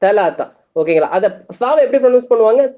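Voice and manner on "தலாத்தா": தலாத்தா with both th sounds turned to T; tongue tip strikes the palate edge in தலாத்தா; Egyptian T. Shown as a demonstration. Teacher showing Egyptian three, தலாத்தா with plain T. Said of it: "Egyptian எப்படி pronounce பண்ணுவாங்க".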